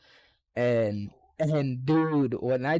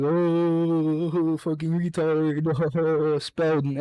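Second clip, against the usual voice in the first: louder, jeering voice of the other team; mocking taunt; 3 semitones higher, 5 dB louder, much slower than usual. Rival team taunting him, loud and jeering. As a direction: jeer at the kid across the gym.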